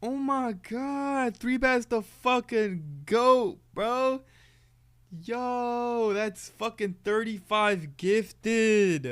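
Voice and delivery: high, slow amazed yelling; stunned hype. Real, not acted. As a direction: drag it out in disbelief. Stunned by gifts.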